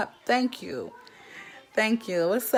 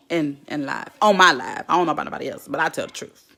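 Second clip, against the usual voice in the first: ordinary talk, nothing marked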